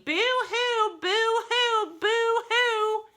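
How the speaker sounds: very high, hammed-up mock crying; fake boo-hoo. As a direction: sob the code word falsetto. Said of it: "he's in there going".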